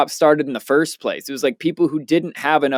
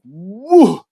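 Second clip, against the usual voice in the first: excited whoop; 6 semitones higher, 5 dB louder than usual. Retold or imitated. Walking up, blown away by lights.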